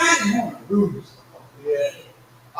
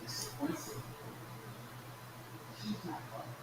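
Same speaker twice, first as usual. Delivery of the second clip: still small voice; 8 semitones higher, 20 dB quieter than usual. Retold or imitated. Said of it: "a still small voice".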